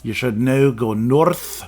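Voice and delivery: slow, light Scottish owl voice; a hint of a wise old Scottish owl; mock Scottish accent. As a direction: read the owl's lines in a half-hearted Scottish accent. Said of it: "Does that not sound Scottish?".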